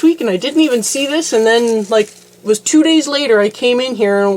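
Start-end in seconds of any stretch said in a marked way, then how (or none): none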